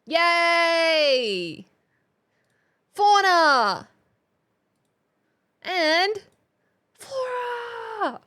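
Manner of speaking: loud, cheerful, high; excited shout. Real, not acted. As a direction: announce it with big excitement. Hyping the next topic.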